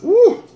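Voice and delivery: high loud whoop from the burn; pained whoop. Real, not acted. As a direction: cry out as the heat hits. Burning from hot sauce.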